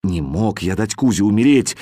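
ordinary delivery

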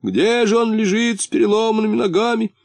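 high, plaintive acted question; lamenting. A narrator's reading. Муфта frets over his friend's broken legs, lamenting. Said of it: "причитал муфта".

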